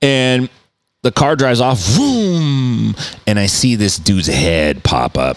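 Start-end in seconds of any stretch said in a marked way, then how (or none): 1.8-2.9 long high vroom; drawn-out engine noise trailing away; imitated car engine. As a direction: mimic a car speeding off.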